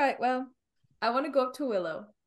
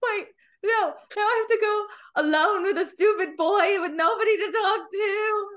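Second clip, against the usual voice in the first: mock crying; 7 semitones higher than usual. Mock sobbing at parting.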